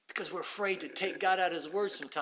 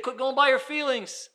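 loud, high, driving sermon voice; full-force preaching; 10 semitones higher, 19 dB louder than usual. Acted. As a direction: preach it out to the whole church.

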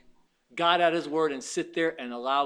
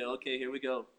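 resigned sigh; 2 semitones lower, 6 dB quieter than usual. Retold or imitated. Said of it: "with the turtle and say".

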